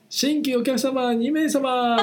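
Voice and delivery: high, slow pub staff shout; izakaya staff call. Retold or imitated. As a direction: call out new customers like bar staff. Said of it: "みたいな".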